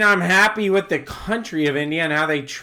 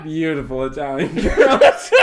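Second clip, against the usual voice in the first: laughing; 5 dB louder than usual. Real, not acted. Laughs while saying it.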